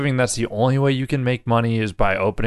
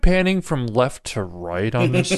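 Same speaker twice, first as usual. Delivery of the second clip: mock film director; 6 semitones higher than usual. S1 voices a film boss to Marty.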